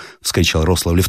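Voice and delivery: ordinary delivery, nothing marked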